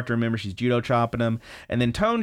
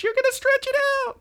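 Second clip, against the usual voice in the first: extremely high cartoon whine; mock squealed protest; faster than usual. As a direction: shriek a hammed-up objection without much volume. Acted protest over a borrowed shirt.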